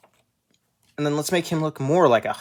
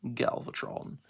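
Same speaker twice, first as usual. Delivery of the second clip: unvoiced dramatic whisper; whispered villain announcement; 9 dB quieter, slower than usual. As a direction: present the villain in a hushed menacing tone. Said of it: "That's a better voice".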